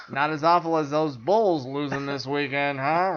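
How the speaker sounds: cheesy anchor bantering with weatherman Joe; mock chirpy anchor. S0 parodies chirpy news anchor.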